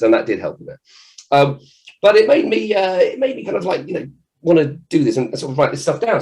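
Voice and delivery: normal talking voice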